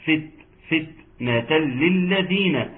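"فت" stopped with an airy puff on the t, then "فتنة للذين" slowly; whispered release of T. Shown as a demonstration. Teacher demonstrates hams to students, "فتنة" slow with an airy t. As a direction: let breath escape after the t of "فت" and "فتنة". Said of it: "فت، همس التاء".

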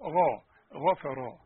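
drawn-out "غفرا", the غ moderately heavy; second-degree heavy gh. Shown as a demonstration. Teacher showing the second degree of غ, slow demo. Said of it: "تاني درجة المفتوح فقط".

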